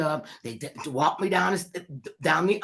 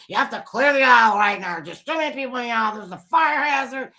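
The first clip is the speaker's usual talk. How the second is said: official order; 6 semitones higher, 6 dB louder than usual. Retold or imitated. Fire marshal ordering crowd.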